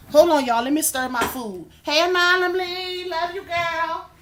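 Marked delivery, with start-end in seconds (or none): 1.8-4.1 high, held-out singing tone; sing-song greeting. Real, not acted. Streamer greets a favorite viewer in the chat, sing-song. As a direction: stretch the hello high and level.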